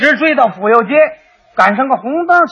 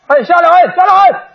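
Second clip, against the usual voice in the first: higher, louder calls of "get down"; tricycle driver yelling; 6 semitones higher, 3 dB louder than usual. Retold or imitated. Tricycle driver yelling at the cyclist whose lamp caught fire, higher, louder. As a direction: shout him off the bike in alarm. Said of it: "有蹬三轮的冲我嚷".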